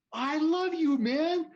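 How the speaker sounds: very high, loud re-enacted shout; yelled affection. Driver to the man he cut off, yelling. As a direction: yell affection as if it were an argument. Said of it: "we're still kinda yelling".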